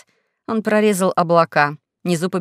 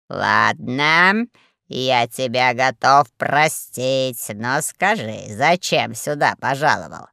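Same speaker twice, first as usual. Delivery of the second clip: low, slow grudging reproach; deep, deliberate scolding voice; lordly boss; 4 semitones lower, slower than usual. A narrator's reading. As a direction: forgive heavily, then rebuke slowly. Boss forgives, then rebukes.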